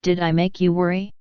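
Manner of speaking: plain reading voice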